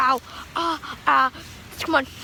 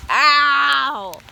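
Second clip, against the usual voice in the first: pained cry; 3 semitones lower than usual. Real, not acted. Hail hitting, cries out in pain.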